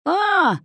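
high loud yelp; howl of disgust. A narrator's reading. Kosoy yelping after the camel spits, high and loud. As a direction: yelp as if spat on. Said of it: "завопил Косой".

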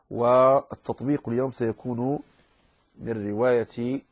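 ordinary talk, nothing marked

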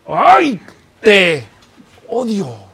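exclamación alta y fuerte que cae; odio teatral. Acted. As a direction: finge un odio exagerado, separando cada palabra. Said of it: "gente que dice". (odio dramatizado)

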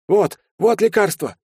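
high, excited exclamation; frantic high voice; breathless urgency. A narrator's reading. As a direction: rush in with the medicine. Servant rushes in with medicine.

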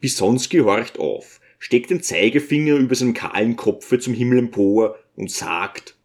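ordinary delivery

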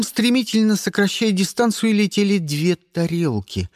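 plain reading voice

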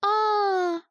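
high, gloating "ah"; gleeful. A narrator's reading. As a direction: pounce on it with delight. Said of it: "обрадовалась дамочка".

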